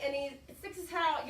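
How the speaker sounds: usual speaking voice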